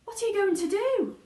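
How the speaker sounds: high, loud anxious question; alarmed cry. Acted. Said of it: "cried Charlie".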